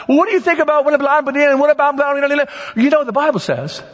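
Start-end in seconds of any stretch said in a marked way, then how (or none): 0.1-2.4 high, rapid blah-blah babble; mock questioning that races into gabble; pestering questioners. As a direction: imitate people firing questions, fast and high. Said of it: "there's gonna be people asking you questions".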